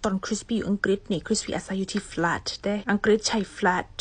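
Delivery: normal talking voice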